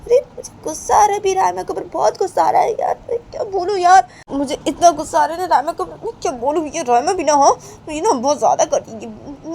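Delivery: high, loud angry outburst; shrieking mock rage about Rama; furious girl. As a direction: explode at the friend. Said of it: "तो बानी बोलती है".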